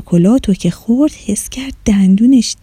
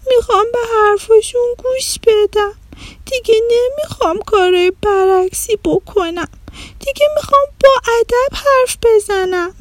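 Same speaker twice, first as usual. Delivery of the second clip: tearful; 11 semitones higher than usual. A narrator's reading. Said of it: "آدیشه با گریه گفت".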